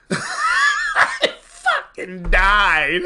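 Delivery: loud laughing shout, slow; laughing hard. Real, not acted. Dad recalling his daughter's line, a loud burst of laughter.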